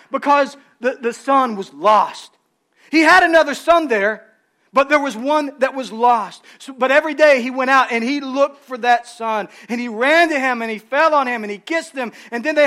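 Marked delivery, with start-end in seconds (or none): none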